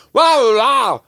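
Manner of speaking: high loud vocal warm-up yelp; quick warm-up shout. Shown as a demonstration. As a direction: blurt it out to wake the voice. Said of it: "You go".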